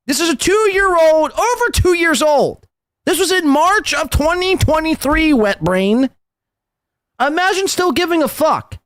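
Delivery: very high-pitched, incredulous needling; mocking exasperation. Real, not acted. Host taunting Chad over a two-year-old bit, exasperated mockery. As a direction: pitch it way up in scornful disbelief.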